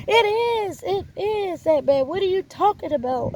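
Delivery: high, loud exasperated protest; upset protest. Retold or imitated. She retells her own protest.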